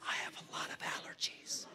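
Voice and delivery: hushed, breath-only whisper; whispered confession. Acted. Comic at a mob restaurant confesses, whispering.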